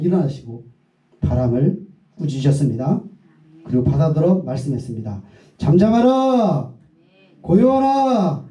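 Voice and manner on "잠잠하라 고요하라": slow, much higher solemn command; slow, high proclamation to the storm; commanding Jesus. Acted. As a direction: draw out each word like a royal decree.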